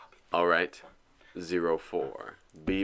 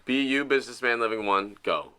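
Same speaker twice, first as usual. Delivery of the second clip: ordinary talk, nothing marked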